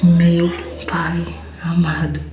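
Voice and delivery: whispered slowly in shock. Real, not acted. Woman whispers in shock over her positive pregnancy test. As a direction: breathe it out in a slow whisper of disbelief.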